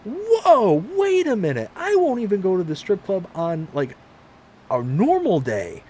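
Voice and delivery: high, loud, mock-shocked whoa; husband shocked by his wife's accusation, high and loud; shocked denial. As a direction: protest your innocence in a high, loud voice.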